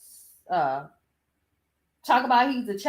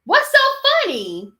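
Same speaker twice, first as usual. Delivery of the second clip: indignant; 10 semitones higher, 7 dB louder than usual. Real, not acted. Indignant at the laughing interviewer.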